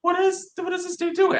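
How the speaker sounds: high-pitched mock disbelief; baffled onlookers. Shopper imitating people stuck in line, baffled. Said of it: "everybody's like".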